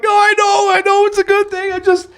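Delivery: very high, loud tearful squeal; acted bawling. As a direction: imitate sobbing while insisting through the tears. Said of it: "I'm bawling, man. I can't even talk right".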